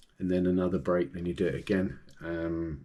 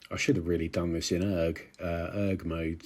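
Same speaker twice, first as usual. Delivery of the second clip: ordinary talk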